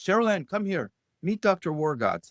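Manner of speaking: high-pitched call, no real volume; mild imitation of calling across a room; mock yell. Father calls daughter over to meet his guest, mild mock yell. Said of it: "just across the room yells".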